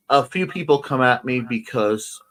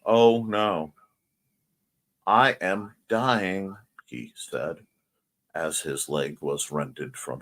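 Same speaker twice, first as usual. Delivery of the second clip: flat deadpan reading; 6 semitones lower, 4 dB quieter, slower than usual. Retold or imitated. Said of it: "it's just flat speech".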